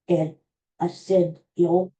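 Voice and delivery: ordinary talk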